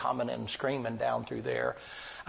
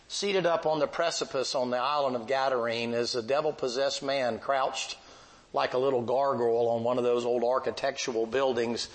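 normal talking voice

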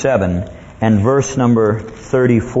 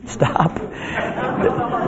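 soft, voiceless plea; whispered plea; 9 semitones higher, 5 dB quieter than usual. Acted. Devil seeing his plan fail at the cross, whispered plea. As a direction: whisper it as if to halt everyone. Devil acted wanting the crucifixion stopped.

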